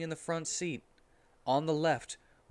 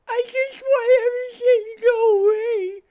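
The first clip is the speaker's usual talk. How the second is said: very high, loud wailing cry; hammed-up crying; 14 dB louder than usual. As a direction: sob the line out shrill and loud. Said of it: "Amy started to cry again".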